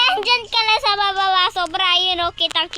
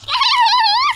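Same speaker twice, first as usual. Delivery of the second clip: shrieking laugh, much higher; high squealing laugh; 8 dB louder than usual. Real, not acted. Laugh during game.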